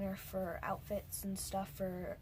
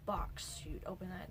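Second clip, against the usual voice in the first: normal talking voice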